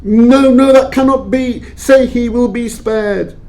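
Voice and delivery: anguished denial. Acted. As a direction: refuse to believe the boy will die. Scrooge hears the child will die.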